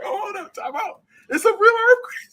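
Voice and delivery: put-on high-pitched kid catching on, fast at the end; sudden realization. Kid realizing mid-show that the quake is real, high and startled. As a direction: squeak out the shock of it being real. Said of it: "I'm like".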